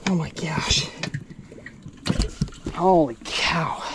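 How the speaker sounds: dumbfounded. Real, not acted. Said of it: "I have no words".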